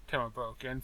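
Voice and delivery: ordinary delivery, nothing marked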